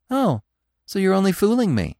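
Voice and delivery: high, pouting reproach; mock hurt. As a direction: act offended. Feline feigns hurt.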